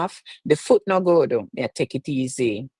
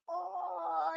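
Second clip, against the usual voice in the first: high cracked cry of emotion; overwhelmed high cry; emotional cry; 15 dB quieter than usual. Real, not acted. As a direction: let the voice break. Overwhelmed by viewers' gifts.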